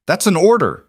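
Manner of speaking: high loud furious command; scream. A narrator's reading. Pinned Marcus screaming at deserting soldiers, loud and high. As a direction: force the order out. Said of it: "Marcus screamed".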